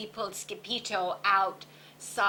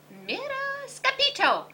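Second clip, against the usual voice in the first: high, carrying howl; howled call. A narrator's reading. Don Diego pointing out the Bobolito, high howl. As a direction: howl the name across the square. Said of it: "howled Don Diego".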